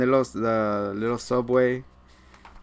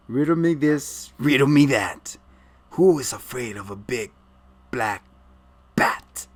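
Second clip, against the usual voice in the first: mock Riddler taunt. Riddler-style rhyme.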